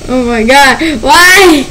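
ordinary talk

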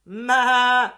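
loud bleat; sheep bleat. Acted. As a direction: baa like a sheep. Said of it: "said the sheep".

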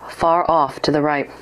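ordinary delivery

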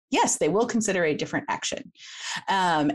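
usual speaking voice

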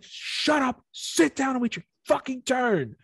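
hammed-up yelling; mock furious yell. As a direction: bark the orders like a drill sergeant. Retold ranting.

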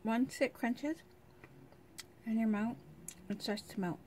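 chewing while talking; crunching candy; mouth full. Real, not acted. Seen 0: Bites and chews small candy, hand at mouth throughout.

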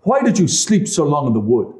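high, loud scolding wife; scolding stepmother. A narrator's reading. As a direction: scold them, shrill with reproach. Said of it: "she said".

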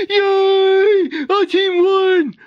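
high, drawn-out celebration; excited cheer. Acted. Team member celebrating first place, excited cheer. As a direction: cheer it high and slow, stretching each word.

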